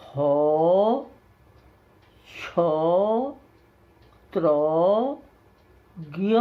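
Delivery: slow, paused recital of each letter; class में वर्णमाला पाठ; reciting letters. Shown as a demonstration. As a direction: read the alphabet aloud for students to follow. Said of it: "प से ज्ञ तक फिर पढ़ता हूँ".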